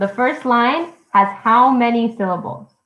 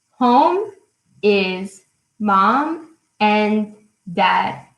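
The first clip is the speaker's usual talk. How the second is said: one beat each for Home, is, mom, and, dad; syllable by syllable; much slower than usual. Shown as a demonstration. Teacher repeating the line for counting, syllable by syllable. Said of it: "I'm gonna tell you again".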